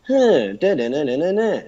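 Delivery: high "da" syllables tracing a sentence; swooping wordless sentence tune; intonation-only syllables. Shown as a demonstration. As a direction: chant a speech melody without words. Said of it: "try to sound just like me".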